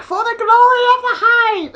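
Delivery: high-pitched battle cry; kamikaze bee. Acted. Bee charging in to sting, kamikaze cry. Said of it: "It's like kamikaze. He's like".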